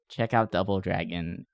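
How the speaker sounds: ordinary talk, nothing marked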